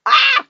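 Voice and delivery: very high sudden yelp; startled scream. Real, not acted. Gamer hit by an arrow, high startled scream. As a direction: shriek at the hit.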